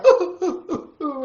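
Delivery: loud high boo-hoo fading off; hammed-up crybaby wail; mock crying. Reading to children, the little boy's playful sob. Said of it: "the little boy cried".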